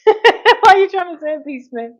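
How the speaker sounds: high, loud laughing question. Real, not acted. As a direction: laugh through the words at a cheeky comment. Laughing at a cheeky comment.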